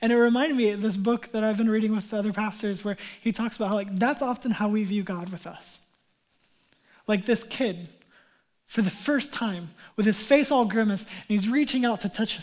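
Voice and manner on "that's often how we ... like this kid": normal talking voice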